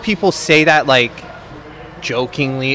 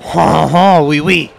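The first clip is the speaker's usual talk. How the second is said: a quick jokey French accent, lightly put on; laughing mock French accent for the Montreal crowd; 4 dB louder, slower than usual. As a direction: toss off a playful fake French accent. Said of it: "you gotta use a French accent".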